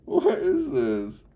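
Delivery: high, loud and slow; amazed disbelief. Real, not acted. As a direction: stretch it out, baffled and amused. Laughing at a strange TTS voice.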